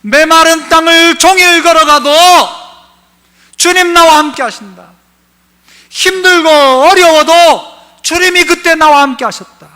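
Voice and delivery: high, loud declaration, slowing on 힘들고 어려워도; fervent preaching. Acted. Pastor proclaiming the believer's confession to the congregation. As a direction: proclaim each line with rising fervor.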